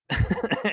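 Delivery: real loud laugh. Real, not acted. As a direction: burst of high laughter. Laughs at own quote.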